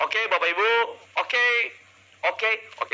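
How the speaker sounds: stalling on "oke" with long gaps; halting, repeated filler words; verbal filler demo. Shown as a demonstration. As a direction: mimic a speaker who leans on "oke". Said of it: "Anda kurangi".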